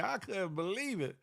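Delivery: ordinary delivery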